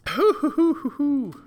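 high, airy run of hoos; high hooting. Real, not acted. Host resetting after a dry-throat cough, high hooting. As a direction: hoot lightly to clear the throat.

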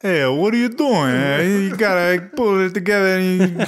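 put-on loud, high-pitched Italian accent; animated Italian accent, pitched well up. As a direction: scold loudly in a hammed-up Italian accent. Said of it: "do some Italian for us".

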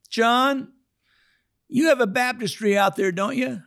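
mock high Fred voice; high-voiced Fred. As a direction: chirp it up high like the old man. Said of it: "now he doesn't talk like this".

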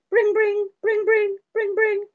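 high sing-song bring bring; phone ring imitation.

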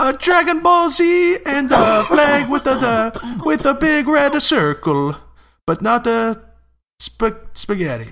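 very high, loud, drawn-out Japanese caricature; hammed-up Japanese accent; mock Japanese accent. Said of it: "Hilarious Japanese accent".

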